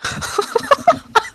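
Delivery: high-pitched embarrassed laugh. Real, not acted. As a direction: break into a squeaky laugh. Player flustered by flirting.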